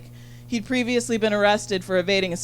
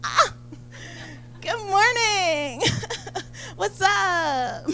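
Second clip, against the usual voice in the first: very high, slow, sing-song cheerful greeting; hammed-up oblivious chirpy morning voice; girlfriend waking up; 11 semitones higher, much slower than usual. Girlfriend strolling out carefree in the morning, high and sing-song. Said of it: "She's like".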